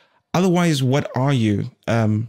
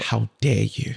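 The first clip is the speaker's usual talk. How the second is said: Greta Thunberg; 3 dB quieter than usual. Acted. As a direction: scold them in a put-on young campaigner's voice. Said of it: "That was a pretty good impre".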